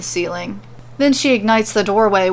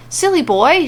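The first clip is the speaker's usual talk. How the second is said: higher-pitched, mildly grumbling retort; a touch of grumbling, pitched up; 7 semitones higher, 3 dB louder than usual. A narrator's reading. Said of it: "she grumbles".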